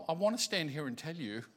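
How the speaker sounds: normal talking voice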